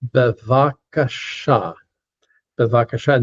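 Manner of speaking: Bevakasha drawn out syllable by syllable, accent on the final "sha"; teacher modelling Bevakasha with end stress; last-syllable accent. Shown as a demonstration. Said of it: "because that's where the accent goes".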